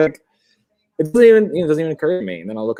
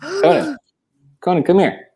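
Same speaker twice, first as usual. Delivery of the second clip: higher-pitched call to the dog; 3 dB louder than usual. Real, not acted. Owner to a dog wandering off to bed, calling. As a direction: call the dog over, voice lifted.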